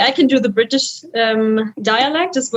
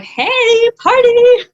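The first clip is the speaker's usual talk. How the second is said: higher, louder, giddy shout; tipsy party cheer; 10 semitones higher, 5 dB louder, much slower than usual. Retold or imitated. After one cup of Glühwein, a giddy party shout. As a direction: cheer like the wine just kicked in. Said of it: "Then I'm like".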